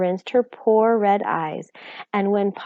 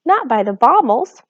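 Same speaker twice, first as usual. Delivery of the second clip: lightly voiced fretting housewife; a hint of a fretful little mother; Homily, fretful mother; 6 semitones higher, 4 dB louder than usual. A narrator's reading. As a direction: worry it out like a fussing mother. Homily frets.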